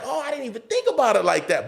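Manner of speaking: high, retold amazement; sudden realization. Comic hears a better punchline, high realization. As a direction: pitch it up as it dawns. His amazed reaction to advice.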